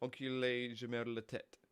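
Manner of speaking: "Enculé, je merde la tête" sounded out low in French; French pronunciation demo. Shown as a demonstration. Streamer explaining French swear words, low careful "Enculé, je merde la tête". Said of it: "I was meant to say shithead".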